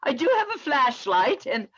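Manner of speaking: a light posh English gent lost in the woods; English accent. Acted. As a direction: play a cheerful Englishman making the best of it. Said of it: "you tend to have a Southern and an English accent".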